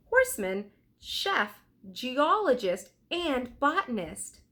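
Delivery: lightly voiced boy reading facts, a bit brighter; reading aloud from a book. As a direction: read the book out like an eager kid. Jack keeps reading aloud.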